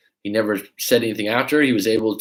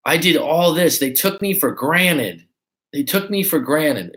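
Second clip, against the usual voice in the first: mock aggrieved complaint; 6 semitones higher, slower than usual. Mimics complaining dumpees.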